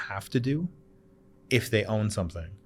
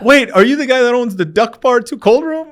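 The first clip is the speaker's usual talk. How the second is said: much higher and louder, starstruck question; excited recognition; 14 dB louder than usual. Retold or imitated. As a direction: gasp it out like a fan meeting a star. Border agents recognize him.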